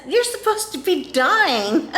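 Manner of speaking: high-pitched blurt, breaking into laughter; blurted disbelief. Acted. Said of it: "I said".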